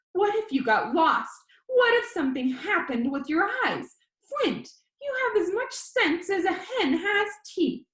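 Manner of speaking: high, exasperated grandma voice; grandma voice, high and loud with a laugh at the end; scolding grandma. A narrator's reading. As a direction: fret and scold at him.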